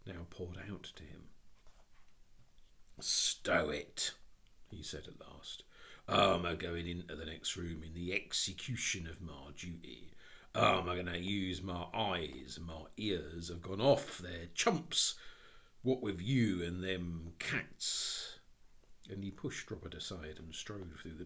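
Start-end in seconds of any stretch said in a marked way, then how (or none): 3.0-4.1 weary, firm policeman putting his foot down; stern constable's order; gruff policeman. Acted. 6.1-18.4 slow, emphatic constable with working-class speech; heavy, drawn-out officialdom; stolid policeman. Acted.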